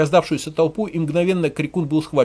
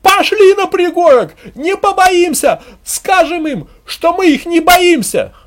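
high, loud, slow female shout; drawn-out high rabble-rousing yell; woman's rallying cry; 14 semitones higher, 8 dB louder, slower than usual. A narrator's reading. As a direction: scream the villagers into action. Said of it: "женский крик".

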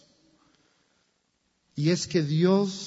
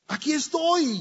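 father calling back; 10 semitones higher than usual. Acted. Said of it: "gritaba el padre".

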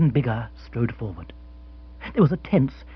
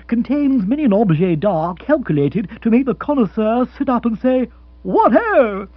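put-on high, loud, hearty young aristocrat; hearty Rory; 7 semitones higher, 4 dB louder than usual. Rory selling the old house to Mrs Spotsworth, heartily. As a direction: boost the place with jolly gusto.

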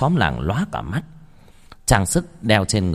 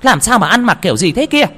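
startled shout; 10 semitones higher, 6 dB louder than usual. Acted. Mother startled by son's call.

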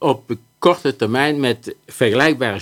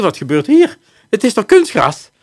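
alarmed teacher; 10 semitones higher, 5 dB louder than usual. Retold or imitated. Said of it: "een x-aantal onderwijzeressen vliegen naar buiten".

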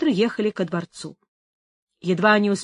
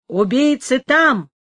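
raised, higher, drawn-out call; acted shout; 4 semitones higher, 4 dB louder, slower than usual. A narrator's reading. Leon misdirecting the crowd after the shots, shouting. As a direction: call it out slowly, pitched up. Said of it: "крикнул Леон".

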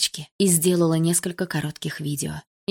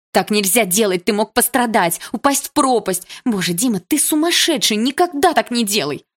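louder, higher tearful telling-off; angry scolding shout; 4 semitones higher, 5 dB louder than usual. A narrator's reading. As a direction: shout at him, fear turned to rage. She scolds him after scare.

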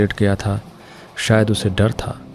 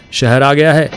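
shout; 6 semitones higher, 7 dB louder than usual. A narrator's reading. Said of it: "ऊंची आवाज में चिल्लाया".

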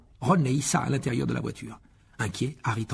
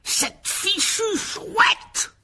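high, drawn-out furious bellow; acted enraged uncle's roar; thundering voice; 7 dB louder, much slower than usual. A narrator's reading. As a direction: thunder it out word by word. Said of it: "la voix tonitruante de l'oncle Vernon".

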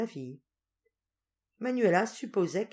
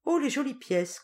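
high delighted oh; delighted gasp; 9 semitones higher, 4 dB louder than usual. Acted. Duenna admiring the gold coins, high delight. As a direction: coo over the coins.

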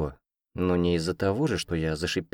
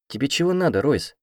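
challenging; 7 semitones higher than usual. A narrator's reading. Heroine confronts Royce, hands on hips.

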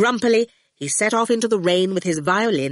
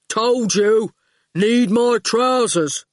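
slow, terse thug; slow goon voice; 3 semitones higher, 4 dB louder, slower than usual. Acted. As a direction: plod out each word. The goon's terse voice.

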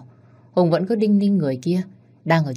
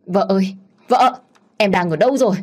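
raised, louder call, pitched up; helpless cry out; 4 semitones higher, 6 dB louder than usual. A narrator's reading. Husband searching the empty flat for his wife, helpless shout. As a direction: call out for a missing wife, at a loss. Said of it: "Cậu hét lên đầy bất lực".

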